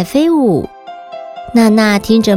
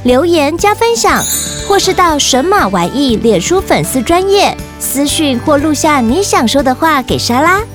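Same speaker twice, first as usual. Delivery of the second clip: podcast promo read; 4 semitones higher than usual. Acted.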